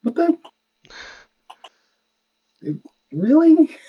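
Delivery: high, loud startled disbelief; surprised. Real, not acted. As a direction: caught off guard, pitch shooting up. Shocked at hearing tunnel sound.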